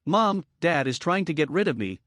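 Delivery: ordinary delivery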